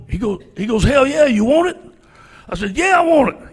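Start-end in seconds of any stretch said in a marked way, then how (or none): 0.8-1.8 high, loud eager offer; excited offer. Retold or imitated. Coach offering job, retold. 2.8-3.5 high, loud eager acceptance; eager yes. Retold or imitated.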